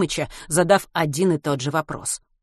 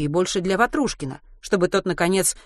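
usual speaking voice

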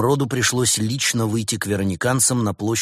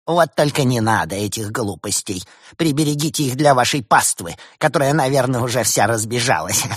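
mocking rival; 3 semitones higher, 4 dB louder than usual. A narrator's reading. Rival mocks Bars.

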